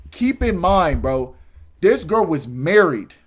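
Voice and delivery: loud, slow reveal; loud, slowed emphasis; emphatic reveal. Real, not acted. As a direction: lean in and stress it. Emphatic aside to viewers.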